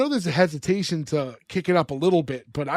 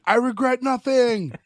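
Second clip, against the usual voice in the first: mock yell; 7 semitones higher than usual. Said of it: "still in it yelling".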